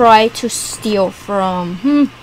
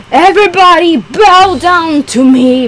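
louder, higher, bow-down decree; commanding villain shout; 6 semitones higher, 6 dB louder than usual. Pretend. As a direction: command them all to bow. Villain demands bowing.

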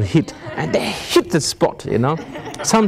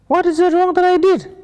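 innocent high protest. Retold or imitated. Retorting to his wife.

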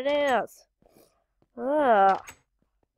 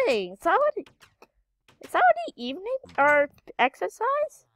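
shooting much higher on already evening; surprised gamer yelping that it is already evening; 9 semitones higher than usual. Real, not acted. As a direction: genuine disbelief at the time. Shocked at the game clock.

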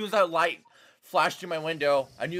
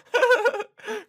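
loud laugh; 6 dB louder than usual. Real, not acted. Laughs at game event.

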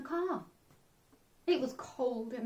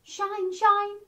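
higher sing-song call; sun voice; 9 semitones higher, 6 dB louder than usual. Acted. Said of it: "went the sun".

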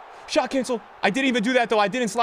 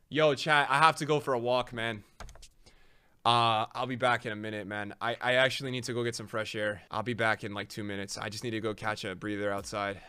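drained and tense; 10 dB quieter than usual. Real, not acted. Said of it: "I'm really tense".

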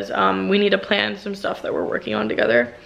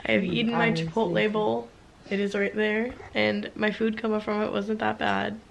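much softer, tired talk from bed; sleepy bedtime voice; 9 dB quieter than usual. Real, not acted. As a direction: murmur like you're about to fall asleep. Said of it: "I am so tired".

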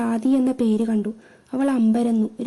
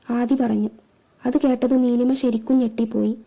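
ordinary delivery

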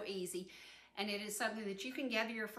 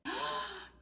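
excited gasp; 10 semitones higher than usual. Real, not acted. Peeks at cobbler in oven.

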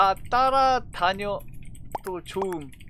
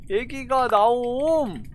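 normal talking voice

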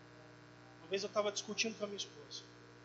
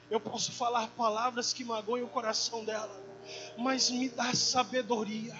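slow, louder, earnest plea to God; pleading prayer; 3 semitones higher, 3 dB louder than usual. Retold or imitated. Husband asking the Lord for wisdom for his wife, pleading prayer. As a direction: beg for help, drawing out the words.

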